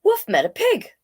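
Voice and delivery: plain reading voice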